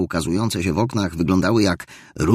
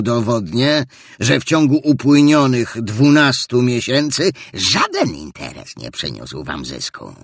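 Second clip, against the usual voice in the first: put-on ranting old Scrooge; 6 semitones higher, 4 dB louder than usual. Scrooge rants against Christmas.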